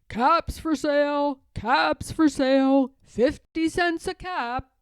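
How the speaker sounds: loud sing-song peddler call; calling out wares. A narrator's reading. Peddler calling to the town, loud sing-song call. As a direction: cry your wares down the street.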